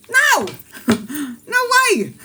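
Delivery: very high, emphatic no way; high squeaky no. Real, not acted.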